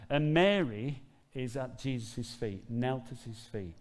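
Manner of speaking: ordinary talk, nothing marked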